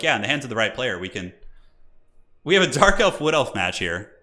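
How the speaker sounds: ordinary talk, nothing marked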